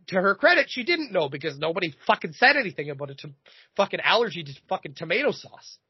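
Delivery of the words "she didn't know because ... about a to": ordinary talk, nothing marked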